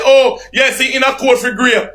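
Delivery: high, squeaky mock voice; mocking quote. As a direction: pitch it way up to ridicule him.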